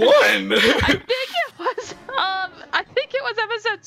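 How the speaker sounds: high-pitched giggling through the words; laughing. Real, not acted. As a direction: laugh helplessly while talking. Cracking up mid-sentence.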